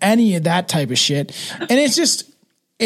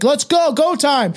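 mock hype; 7 semitones higher, slower than usual. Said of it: "like, just, like".

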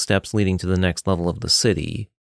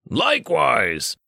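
grunted; 6 semitones higher, slower than usual. Acted. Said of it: "Richter grunted".

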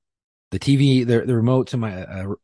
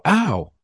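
sharp high yelp of pain; 10 semitones higher, 3 dB louder than usual. Retold or imitated. Retelling the suspect's yelp when tased, a sharp high ow. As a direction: yelp like the darts just hit.

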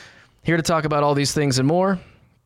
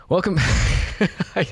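laughing; 5 semitones higher, much slower than usual. Real, not acted. Breaks into laughter mid-intro.

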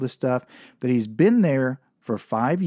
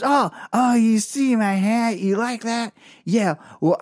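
put-on slow, higher-pitched drunk woman; drunken slur; 5 semitones higher, slower than usual. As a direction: brag proudly in a hammed-up drunken drawl. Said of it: "slurred way".

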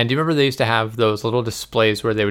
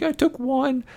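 much higher, small guilty confession; little kid voice; 4 dB quieter, slower than usual. Retold or imitated. Owning up to mom about the gumdrop, in a tiny high voice.

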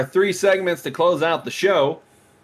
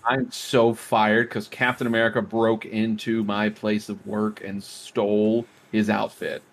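deadpan quote; 7 semitones lower, slower than usual. Acted. Mockingly voices the security guard quote.

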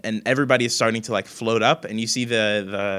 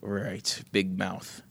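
softer, weary grumble; grumbling sigh; 9 dB quieter, much slower than usual. Acted. Gunther grumbling about Wes, soft weary sigh. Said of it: "He kinda sighs and goes".

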